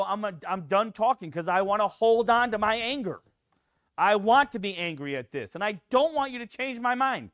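high, loud defiant whine; stubborn angry believer. Retold or imitated. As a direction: refuse God like a sulking child. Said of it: "or you go".

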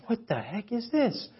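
quiet, high mock alarm; voicing a startled uncle under his breath; a hint of an uncle freaking out; uncle's shocked question. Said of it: "And he's like".

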